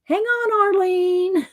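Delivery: high-pitched playful doll voices; squeaky back-and-forth between two dolls; high doll banter; Janet and Arlene voices. Retold or imitated. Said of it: "Janet goes".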